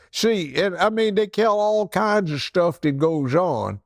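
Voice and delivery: ordinary delivery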